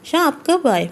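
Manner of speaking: startled high-pitched cry of his name; startled, nervous wife; flustered alarm. A narrator's reading. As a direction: caught off guard, panicky and high. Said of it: "Meerab گھبراتی ہوئے".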